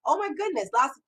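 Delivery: ordinary talk, nothing marked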